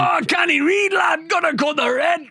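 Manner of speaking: high, frantic Geordie yelling; Geordie shout. Acted. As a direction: yell urgent orders in broad Geordie. Geordie yelling during bomb scene.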